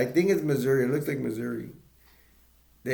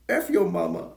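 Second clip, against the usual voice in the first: higher imitated put-down; pimp's retort; 7 semitones higher, 4 dB louder than usual. Pimp answers lost driver, mock insulting. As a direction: toss off a mock street insult.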